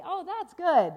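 high, loud burst of delight; delighted refrain. Acted. Storyteller reacts to the balloon ride, loudly delighted. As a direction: cheer the good news.

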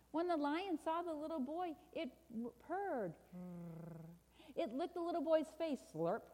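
animated storybook narration; children's story reading. A narrator's reading. Preacher reads a picture book to listeners, animated storytelling. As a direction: read it to children with lively emphasis.

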